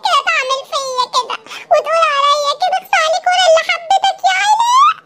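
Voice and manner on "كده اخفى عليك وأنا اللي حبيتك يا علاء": wronged princess bawls at Alaa in a comic whine; mock crying. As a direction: fake wailing, half laughing. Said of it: "خلاص ما تعيطيش".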